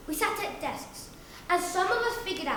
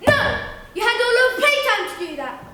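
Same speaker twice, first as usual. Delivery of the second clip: loud, high scolding refusal; strict teacher; 6 semitones higher, 8 dB louder than usual. Acted. Teacher refusing the whiny kid, loud stern scolding. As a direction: shout the pupil down sternly. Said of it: "And she'd say".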